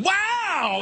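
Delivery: high squealed mock bikini-girl wow; girls going wow. Mimicking beer-ad girls, high mock squeal. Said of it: "girls in bikinis going".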